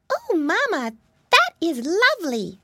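little piglet's cry. A narrator's reading. Baby Pinky admires her mama's bonnet, crying out. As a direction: cry it out high in delight. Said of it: "cried baby Pinky".

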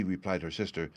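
ordinary delivery

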